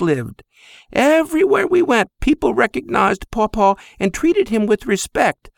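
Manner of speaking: high, tender mother's voice; fond Greek mother. Acted. Mother fondly compares her son to his great-grandfather.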